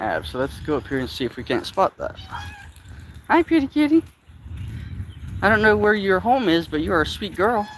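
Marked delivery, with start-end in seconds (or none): 3.3-7.7 high cooing to a kitty; sweet high pet voice. Real, not acted. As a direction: coo at the stray cat. Baby talk to a cat.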